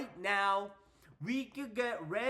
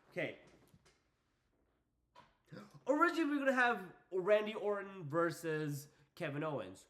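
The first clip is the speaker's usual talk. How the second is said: usual speaking voice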